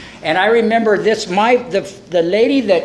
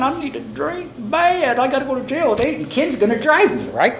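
higher-pitched mock desperation; fretting; 6 semitones higher than usual. Drinker talking himself into a drink before jail, fretting. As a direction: fret aloud with an urgent craving.

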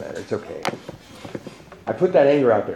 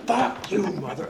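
higher mock yell at the guy telling him to chill; imitating angry yell; 8 semitones higher, 4 dB quieter, slower than usual. Angry stranger cursing him outside the meeting, higher mock yell. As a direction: play the ranting stranger, half laughing. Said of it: "He was yelling, screaming".

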